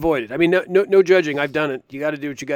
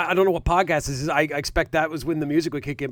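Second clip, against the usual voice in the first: usual speaking voice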